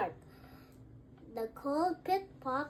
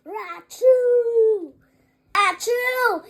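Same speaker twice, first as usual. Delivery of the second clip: loud, higher sneezing rachoo; big sneeze; 6 semitones higher, 9 dB louder, much slower than usual. Acted. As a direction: burst out rachoo as a huge sneeze. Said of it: "He blows and he sneezes".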